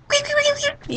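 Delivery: high-pitched squeaky toy noise; squeak. Retold or imitated. Joking about balls the dog may touch, squeaky imitation. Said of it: "the kinds that squeak".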